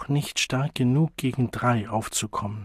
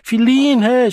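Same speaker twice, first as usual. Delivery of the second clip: put-on very high, loud child's cry; 12 semitones higher, 9 dB louder than usual. A narrator's reading. Child being hit by his mother, crying out. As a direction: scream the name in alarm. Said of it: "schrie er auf".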